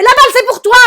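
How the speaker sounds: very high, loud shout; boy's shout. Retold or imitated. Little boy yelling to his mother that the ball is hers. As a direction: yell the warning at top pitch. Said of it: "il fait".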